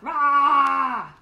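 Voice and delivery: loud playful monster roar; mock angry roar. Showing the yelling girl to children, mock roar.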